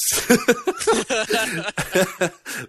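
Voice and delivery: a high burst of real laughter; real laughter while reading the email. Real, not acted.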